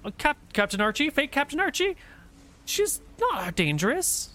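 nervous sailor reassuring his captain; lightly hammed-up high Euclid voice; Euclid's voice. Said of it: "looks at you and goes".

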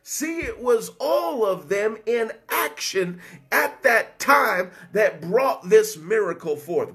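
usual speaking voice

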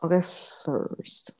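normal talking voice

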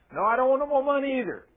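high-pitched imitated protest; upset woman. Upset woman refusing the man's money, high mock protest. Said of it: "she's all upset".

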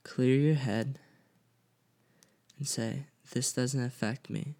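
quietly steady yourself like a calm mantra; a low, calm inner mantra. Shown as a demonstration. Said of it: "you have to stop, clear your head".

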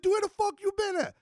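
very high, astonished cry; shocked. Retold or imitated. Seeing the friend back after jail, a very high astonished cry. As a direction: call out in disbelief at seeing him.

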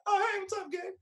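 high, breezy fake-casual hello; mock friendly greeting. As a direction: greet them all sing-song and high. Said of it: "I'm like".

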